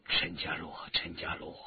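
low, hushed self-address; gentle murmur. A narrator's reading. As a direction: say it very softly, almost under the breath. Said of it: "轻轻地说". (轻声低语)

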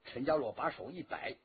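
plain reading voice

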